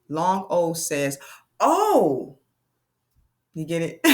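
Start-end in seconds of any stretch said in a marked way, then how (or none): 0.1-2.4 teacher modelling long O; long O sound. Shown as a demonstration. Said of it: "vowel demo".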